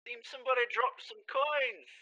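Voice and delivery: very high, hammed-up "oh no"; mock surprise. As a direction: feign shock in a squeaky-high voice. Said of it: "mocks surprise".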